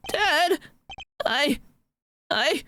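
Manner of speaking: put-on high, meek little boy voice; timid pleading son. Son begging his father for the prosecutor's bench, meek and high. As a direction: plead shyly like a scared kid.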